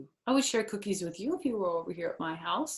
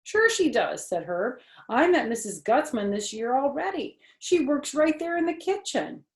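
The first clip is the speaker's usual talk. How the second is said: put-on boy voice; 7 semitones higher, 4 dB louder than usual. Herb voiced.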